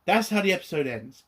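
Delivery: normal talking voice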